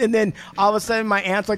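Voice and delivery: ordinary talk, nothing marked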